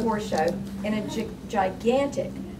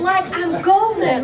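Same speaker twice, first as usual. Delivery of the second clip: higher, louder, slow; triumphant; 10 semitones higher, 10 dB louder, slower than usual. Real, not acted. Telling the panel she is now golden, a playful slow gloat.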